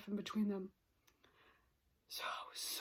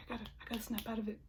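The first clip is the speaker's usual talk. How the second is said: pulling herself together; much faster than usual. Retold or imitated. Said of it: "And then I was like".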